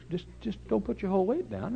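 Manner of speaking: high, lightly put-on careful voice; mock caution. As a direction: advise gingerly, up high. Joking advice repeated.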